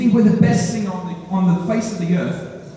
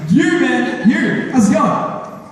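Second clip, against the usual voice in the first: loud, higher, stretched fake-friendly greeting; acting nice; 6 semitones higher, 5 dB louder, much slower than usual. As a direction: cover awkwardness with overdone cheer. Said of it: "I'm still trying to act nice".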